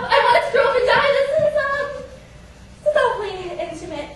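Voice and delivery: high, loud, rushed protest; mortified panic. Acted. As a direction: babble in comic panic, faltering on the ums.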